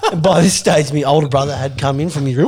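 ordinary delivery

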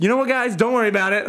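high-pitched salesman-like reassurance; breezy reassurance. Retold or imitated. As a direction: play at brushing off their doubts. Teller reassures doubtful team, acted.

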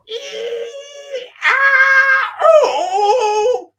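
high shrieking cries through the door; loud high wailing cries; screams and cries. Retold or imitated. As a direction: shriek like a fight upstairs. Parents fighting behind door.

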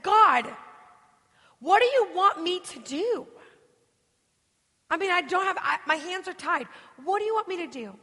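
high, loud cry of "God", then pleading; exasperated plea. Retold or imitated. Woman asks God what to do, exasperated plea. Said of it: "go from anger to being overwhelmed".

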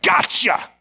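lightly voiced taunting villain; gloating enemy. Preacher voices the enemy catching someone distracted, gloating. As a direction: pounce on it with a gloating cry.

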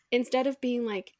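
usual speaking voice

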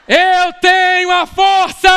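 triumphant hero cry; shouted catchphrase. Acted. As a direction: roar it like a hero raising a sword.

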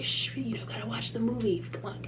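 put-on soft hushing to a child; hushed. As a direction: shush quietly, almost under breath. Hushing her niece in theater.

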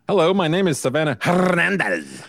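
lightly exaggerated Spanish accent on Hernandez; mock rolled R's. Co-host mocks a reporter rolling R's, playful. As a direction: roll the R's in Hernandez, a touch overdone. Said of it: "she roll the R's in her last name".

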